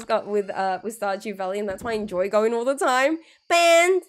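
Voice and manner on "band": cheering. Real, not acted. Moderator bans someone.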